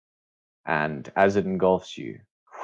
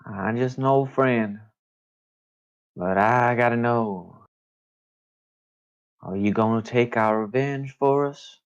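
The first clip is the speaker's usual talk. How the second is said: put-on mysterious old friend; 3 semitones higher than usual. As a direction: stretch the words out as an unseen visitor. Game master plays mysterious voice in mist.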